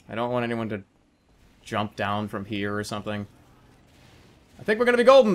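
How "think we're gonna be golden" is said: high, loud excited call. Real, not acted. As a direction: say it pumped up. Excited prediction.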